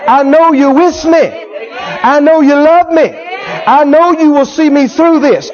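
high, drawn-out cry to God; impassioned declaration of trust, easing down at the end; fervent prayer. Acted. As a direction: preach the prayer at full stretch, slowly. Acted climax of prayer to God.

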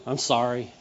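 low, soft little sorry; small sheepish apology. Retold or imitated. Child apologizing to his brother, soft and sheepish. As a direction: mumble it like a child told to apologize.

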